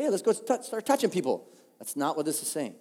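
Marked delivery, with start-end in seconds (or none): 0.0-1.5 high-pitched, over-keen suggestion; lightly acted eager rallying, pitched up; mock eagerness. Preacher mimicking a wrong takeaway, mock eager.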